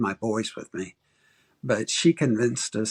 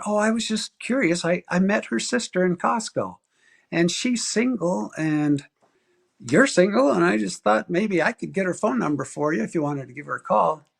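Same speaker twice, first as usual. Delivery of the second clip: lightly raised, eager sister voice; sister's voice; 6 semitones higher than usual. Retold or imitated. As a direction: mimic the sister pitching a date idea. Sister voiced in retold call.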